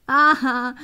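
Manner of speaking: loud startled outburst. Acted. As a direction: jolt at the news. Boyfriend hears shocking news. (sobbalzo di sorpresa)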